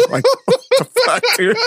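laughing in disbelief. Real, not acted. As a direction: laugh through the words. Laughing at absurd encounter.

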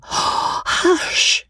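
high, breathy unvoiced hush; urgent whisper. A narrator's reading. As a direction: hush her sharply under the breath. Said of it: "cried Miss Sedley".

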